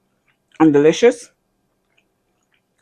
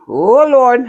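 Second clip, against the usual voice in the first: surprised; 7 semitones higher, 5 dB louder than usual. Real, not acted. Sudden exclamation noticing mistake.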